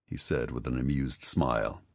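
plain reading voice

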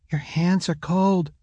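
put-on high-pitched small child's voice; concerned boy. A narrator's reading. Boy takes mother's hand, high childlike voice. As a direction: voice a boy gently, pitched well up.

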